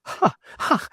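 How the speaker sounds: airy laugh, high and unvoiced; breathy high laugh. Holmes laughs at Roylott's exit with an airy laugh. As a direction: laugh it off lightly. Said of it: "said Holmes, laughing".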